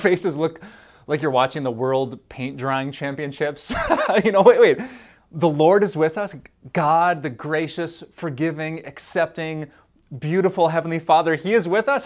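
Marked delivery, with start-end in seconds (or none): 4.4-12.1 fired-up exclamation. Acted. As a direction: shake them awake with joy. Preacher rouses congregation.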